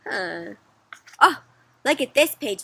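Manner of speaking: usual speaking voice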